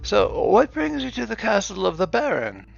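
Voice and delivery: put-on high, fussy old clerk voice; flustered old accountant. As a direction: backpedal like a nervous old man. Leif backpedals.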